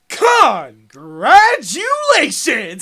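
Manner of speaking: drawn-out, high game-show shout; booming prize announcement. Retold or imitated. Prank caller announcing a fake prize, loud drawn-out shout. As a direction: belt it out long and loud like a prize host.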